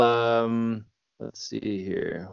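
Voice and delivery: normal talking voice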